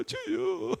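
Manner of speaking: high, loud acted wail to the Lord; mock crying prayer. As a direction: cry out upward as if weeping in prayer. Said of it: "그때 하나님 앞에 우는 거예요".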